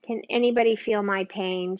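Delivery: ordinary talk, nothing marked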